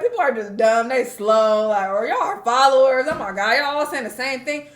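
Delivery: loud, mildly exasperated venting; exasperated rant. Retold or imitated. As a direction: sound fed up with everyone, loudly. Venting about gullible commenters.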